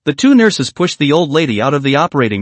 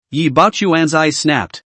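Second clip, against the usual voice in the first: ordinary delivery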